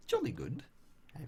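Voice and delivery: playful mock British accent, pitched high; high, hammed-up British cheer. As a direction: put on a jaunty British "jolly good". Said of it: "do my British accent".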